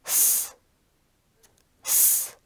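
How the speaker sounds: "S" as a long voiceless hiss, not the letter name; German eszett sound. Shown as a demonstration. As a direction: hold "S" as a pure sss. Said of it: "is pronounced like".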